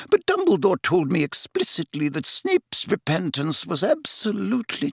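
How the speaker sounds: put-on high-pitched, wavering older professor; upset professor defending a trusted friend; distraught McGonagall. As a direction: protest weakly, pitched well up. Narrator voices upset McGonagall.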